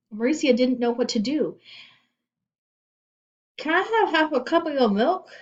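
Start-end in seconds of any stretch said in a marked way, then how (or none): 3.6-5.3 put-on high, loud kid's voice; a child begging a share; child's request. A narrator's reading. Child asking for milk.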